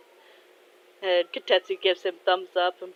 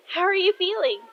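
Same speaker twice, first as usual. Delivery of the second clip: high, loud, bubbly question; excited girl; 12 semitones higher, 5 dB louder than usual. Acted. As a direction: ask eagerly in a high kid voice. Excited girl questioning her idol.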